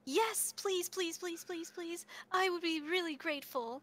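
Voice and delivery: rapid string of pleases, pitched high; eager pleading. Acted. Museum worker begging helper to fetch a case, eager pleading.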